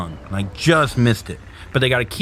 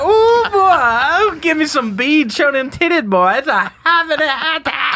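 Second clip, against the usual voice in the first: much higher, louder giddy drugged voice; high on Demerol; 4 dB louder than usual. Retold or imitated. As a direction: ham up being blissfully high. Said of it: "I was so fucked up".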